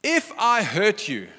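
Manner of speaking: slow, high emphasis on IF; defensive fake apology, drawn out; non-apology. Shown as a demonstration. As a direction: lean hard on "If", conceding nothing. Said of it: "let me teach you how not to apologize".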